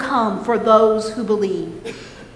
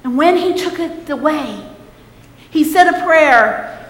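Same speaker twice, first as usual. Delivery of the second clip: normal talking voice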